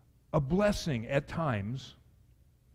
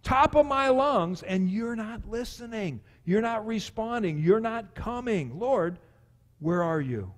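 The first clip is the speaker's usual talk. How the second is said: desperate pleading; 5 semitones higher, 4 dB louder, slower than usual. Retold or imitated. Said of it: "I'm crying out".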